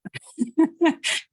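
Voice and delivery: a high laugh before speaking. Real, not acted. Laughing as the host calls on her to share, a high laugh. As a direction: laugh as you take the floor.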